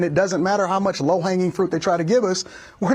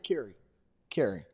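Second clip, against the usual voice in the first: low mock call; 12 semitones lower, 12 dB quieter than usual. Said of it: "I said".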